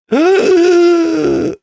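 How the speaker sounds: sharp, high gasp of disbelief; shocked gasp. Real, not acted. Reacting to shocking clip match.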